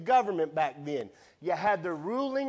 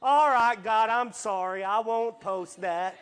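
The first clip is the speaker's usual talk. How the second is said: mock loud apology; 5 semitones higher, 6 dB louder than usual.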